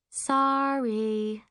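high, drawn-out fake apology; nasty smile. A narrator's reading. Liana mock-apologizes across the boats, nasty smile. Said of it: "Liana mouths, smiling nastily".

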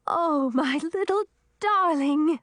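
tender, swelling joy; overjoyed mother. A narrator's reading. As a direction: gush over your baby in a high voice. Mother hugs her restored baby.

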